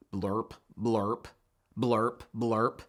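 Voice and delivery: deep, soft croaking blurps; low frog croak. A narrator's reading. Frog croak in the watering can.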